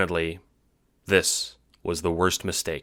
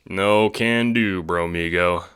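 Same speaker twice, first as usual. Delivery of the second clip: slow laid-back refusal; 3 dB louder, slower than usual. Acted. Dave refusing casually.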